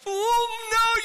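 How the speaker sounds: high, loud drawn-out cry; hammed-up wail of dismay; retold dismay. Dad watching the wave peel across his daughter's face, dismayed.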